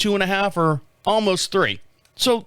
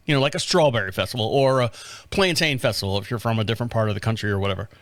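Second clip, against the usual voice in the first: usual speaking voice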